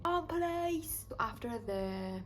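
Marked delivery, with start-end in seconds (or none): 0.1-1.1 London accent. Shown as a demonstration. Vlogger showing how Line of Duty must be quoted, in a London accent. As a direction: demo the London copper accent once more, stretched out. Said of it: "that London accent".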